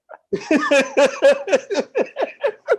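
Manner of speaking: high, staccato burst of laughter; real giggling fit. Real, not acted. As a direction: crack up in high pulses. Cracks up at his own dodge.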